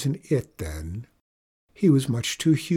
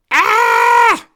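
high, loud "Ah!" scream; 13 dB louder than usual. A narrator's reading. Narrator screams on finding a creature in the closet, high loud scream. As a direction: let out a piercing held shriek. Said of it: "and screamed".

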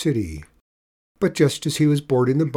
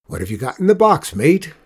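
louder, fast challenging captain voice; hearty, loud old captain questioning; loud sea captain; 4 semitones higher, 6 dB louder than usual. Acted. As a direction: call him out at the gangplank. Sea captain challenges Juan boarding.

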